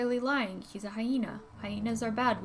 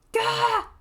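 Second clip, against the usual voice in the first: high yelp of fright; startled yelp; 13 semitones higher, 8 dB louder than usual. Acted. Luca startled by Dawn, sharp yelp. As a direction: jump and yelp.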